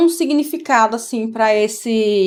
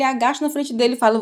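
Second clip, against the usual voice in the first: usual speaking voice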